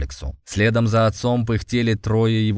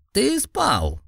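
sad smile; 14 semitones higher than usual. Acted. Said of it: "грустно улыбнулся пожилой жрец".

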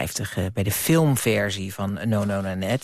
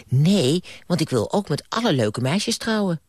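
put-on childish Bram; 6 semitones higher than usual. Bram boasting to the ghost, higher childish voice. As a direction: blurt it like a boastful kid. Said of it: "antwoordde Bram".

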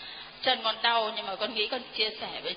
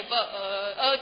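ordinary delivery

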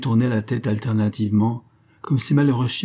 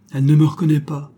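fast, higher, louder cry of despair; 5 semitones higher, 6 dB louder, faster than usual. Acted. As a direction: blurt it out in despair. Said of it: "s'écria le colonel au désespoir". (cri désespéré, rapide et fort)

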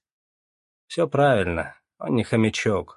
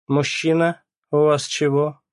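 curt, cold clerk's question; 6 semitones higher, 5 dB louder than usual. A narrator's reading. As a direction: brush him off like a stranger. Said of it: "холодно спросила она".

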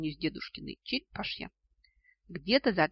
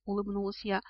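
ordinary delivery

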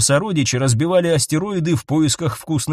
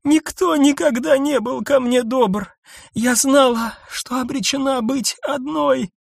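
despairing confession; 10 semitones higher than usual. A narrator's reading. Nissa confesses loneliness.

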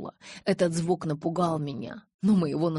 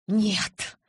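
short, higher-pitched blurt; involuntary outburst; 5 semitones higher, 3 dB louder than usual. A narrator's reading. Bella reacts to her realization, involuntary outburst. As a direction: let it burst out of you. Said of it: "вырвалось у меня".

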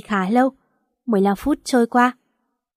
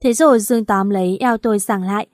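ordinary delivery, nothing marked

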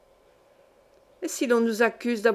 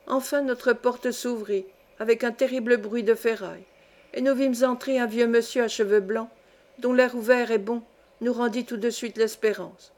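ordinary delivery, nothing marked

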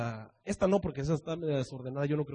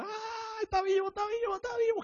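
very high, hushed joyful cry; overjoyed Mary; 5 dB quieter than usual. Acted. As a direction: gasp out that he is alive. Mary runs off rejoicing. (María eufórica, muy aguda)

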